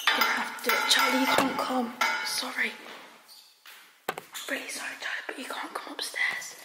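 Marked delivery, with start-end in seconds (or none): 4.1-6.6 hushed, frightened whisper; scared whisper. Real, not acted. Girl sneaking upstairs at night, whispering scared to viewers. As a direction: whisper so the parents don't wake.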